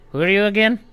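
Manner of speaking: high, loud, baffled question; confused old gnome. Acted. Forgetful old gnome asking Thrawn, high and loud. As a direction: ask like you've forgotten everything. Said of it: "be like".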